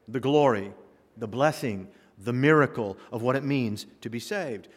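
ordinary talk, nothing marked

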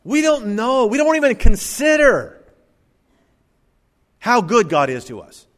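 loud, very high-pitched preacher's outcry; impassioned exclamation. Real, not acted. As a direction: cry it out high and forcefully to the congregation. Preacher exclaiming at congregation's ingratitude.

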